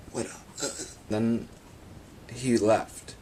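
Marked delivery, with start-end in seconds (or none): 0.1-0.7 unvoiced whispered greeting. Puffer fish whispering a greeting. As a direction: breathe it out as a whisper.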